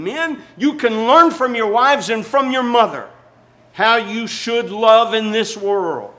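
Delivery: loud, high, slowing preacher's call to the men; rising sermon cry, stretched out at the end; preaching exhortation. Real, not acted. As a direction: drive it home to the men at full force.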